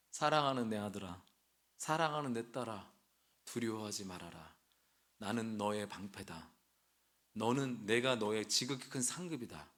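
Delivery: put-on God speaking softly to a frightened child; tender God. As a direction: hush and reassure like a loving father. God comforting his children.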